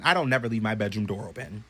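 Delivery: ordinary delivery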